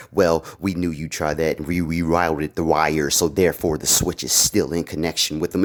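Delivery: low, menacing villain voice; villain threat. Retold or imitated. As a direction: play the bad guy gloating over his switch. Villain countering the hero.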